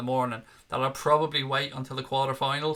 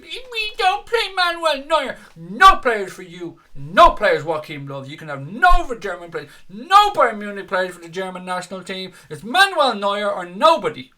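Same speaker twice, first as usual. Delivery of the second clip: mocking Uli Hoeneß; 6 semitones higher, 8 dB louder than usual. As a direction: play him as a petulant, sulking child. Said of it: "Basically acting like a bit of a bitch there, Uli".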